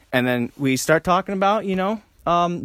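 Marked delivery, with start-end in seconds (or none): none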